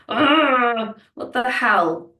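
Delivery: mock fed-up outburst at a situation out of control; frustrated exasperation. Imitating her frustrated self, fed up and exasperated. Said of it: "you're angry and you're frustrated and you're like".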